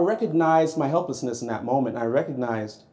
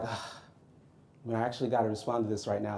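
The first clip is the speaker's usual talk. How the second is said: reluctant groan; 6 semitones lower, 11 dB quieter, faster than usual. Retold or imitated. Reluctant inner reply to wife.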